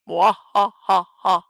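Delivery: slow theatrical mwahahaha; evil villain cackle. Acted. Villain laugh after Halloween line.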